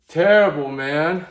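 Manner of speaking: loud frustrated shout of disgust; angry outburst. Real, not acted. Gun owner yelling at the stripped screw, loud anger. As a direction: yell it out, fed up and furious.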